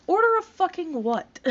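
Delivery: sudden high disbelieving squawk; incredulous outburst. Real, not acted. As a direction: blurt it out, totally incredulous. Mocking absurd comment.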